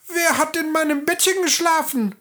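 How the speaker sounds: first dwarf finds his rumpled bed, frantic. A narrator's reading. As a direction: blurt it out in a fluster. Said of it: "Hektisch sah sich der erste Zwerg".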